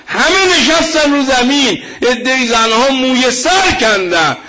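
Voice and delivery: full-force preaching. Acted. Preacher urging his audience about Zaynab's mission, impassioned preaching. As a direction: preach it to the crowd with rising fervor.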